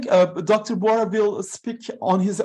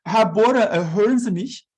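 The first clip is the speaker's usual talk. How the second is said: raised voice calling into the call; 5 semitones higher, 6 dB louder than usual. Real, not acted. Host calls loudly to Bora on the call, raised voice. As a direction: call out to reach an absent speaker. Calling an absent speaker online.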